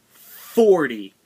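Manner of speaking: loud, sudden shout of the number. Real, not acted. Vlogger shouting the number at viewers, loud and sudden. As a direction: bark out the number in disbelief.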